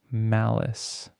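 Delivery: ordinary delivery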